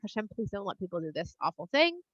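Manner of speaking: low, soft, hushed plea; praying. Acted. Moshe and Aaron begging Hashem for the people, quiet prayer. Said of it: "start praying to Hashem".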